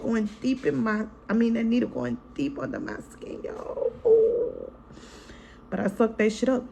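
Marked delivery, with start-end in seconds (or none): 4.0-4.7 high wincing ooh; a sharp pained cry of discomfort. Real, not acted. Recalling needle under her skin, high wince. Feeling stitches without numbing.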